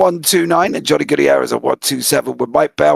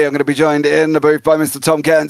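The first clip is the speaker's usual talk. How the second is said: ordinary talk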